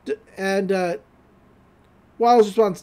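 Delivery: ordinary talk, nothing marked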